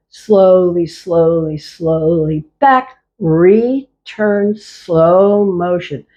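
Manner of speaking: slowed right down, each word stretched; slow motion. Shown as a demonstration. Teacher slowing the class's moves down, slow and drawn out. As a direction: guide the class at a crawl. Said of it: "set the intention to move more slowly".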